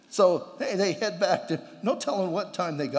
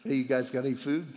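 casual Jesus; 5 semitones lower, faster than usual. Retold or imitated. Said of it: "I love that".

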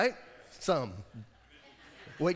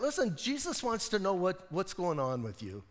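ordinary talk, nothing marked

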